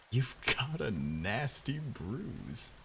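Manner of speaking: reading on while breaking into laughter, slowed; cracking up mid-sentence; laughing through it. Real, not acted.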